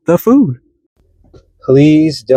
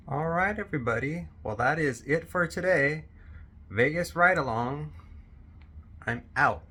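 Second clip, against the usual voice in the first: much softer outro signoff; 18 dB quieter than usual. Real, not acted. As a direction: wrap up quietly.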